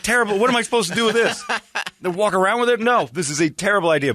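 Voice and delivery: high, fast, laughing mock protest; mock exasperated refusal. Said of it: "I'm like".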